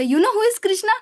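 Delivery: high-pitched imitation of a small child; little girl's voice. Little girl tugging at people's clothes to ask, little voice. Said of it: "she would say in that little voice".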